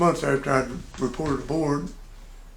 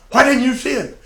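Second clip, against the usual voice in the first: much higher, louder demand; the puzzled boss wanting answers; mock demanding; 10 semitones higher, 7 dB louder than usual. Officer questions the airman.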